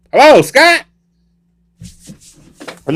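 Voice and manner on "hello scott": high, loud questioning call; comic high-pitched check-in; nervous calling out. Acted. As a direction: holler warily for someone unseen. Calls again after a bang.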